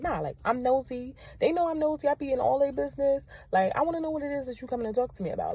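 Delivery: excited retold quote. Retelling what she tells students.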